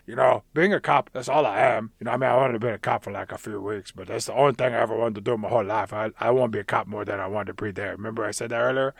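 low, soft mock orc voice; put-on orc, mumbling a downcast confession; Nick the Orc. Orc confiding in his cop partner, hammed-up low and subdued.

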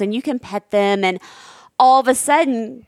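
ordinary talk

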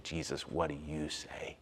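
slow whispered prayer. Acted. Believer asking Jesus for guidance, whispered slowly. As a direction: breathe it as a quiet prayer.

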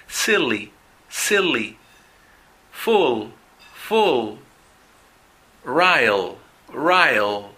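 silly, full, rile with the L drawn out long; long English L. Shown as a demonstration. Teacher modelling the long L for students. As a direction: stretch each final L in silly, full, rile. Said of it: "van a ver que es un poco más largo".

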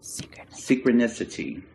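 low, slow echoing intro voice; hushed, drawn-out mystical announcer; mock-mysterious segment title. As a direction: intone the title like a mystic, fading away. Jokey segment jingle, echoed.

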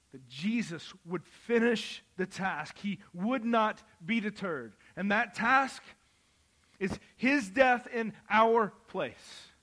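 loud, high, slowed proclamation; preaching at full force. Real, not acted. Preacher proclaiming Christ's death in our place, loud and slow. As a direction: proclaim it slowly and loudly.

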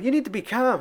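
high mock plea for calm; imitating the Dude begging calm; Dude pleading. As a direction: beg him to calm down. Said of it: "But he's like".

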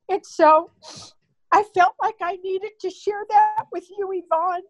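fighting back real tears while speaking; emotional. Real, not acted. Mother sharing with her daughter on Mother's Day, tearful and breaking. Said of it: "I'm sorry if I'm emotional".

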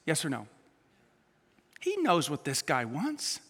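ordinary delivery

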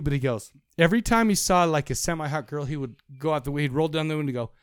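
ordinary talk, nothing marked

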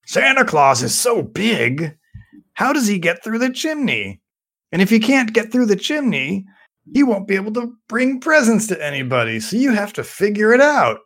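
lightly voiced grumpy puppet; Oscar voice. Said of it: "Oscar asks Big Bird".

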